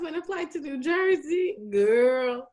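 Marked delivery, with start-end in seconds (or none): none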